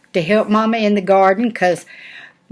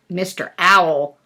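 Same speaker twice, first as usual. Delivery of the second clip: ordinary delivery, nothing marked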